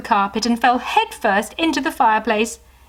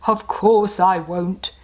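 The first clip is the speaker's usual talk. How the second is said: jolly Santa voice; slower than usual. Acted. Santa's voice in story.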